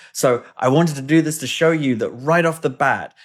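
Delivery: usual speaking voice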